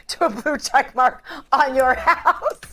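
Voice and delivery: laughing through the words. Real, not acted. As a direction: giggle while teasing. Host laughs at own joke.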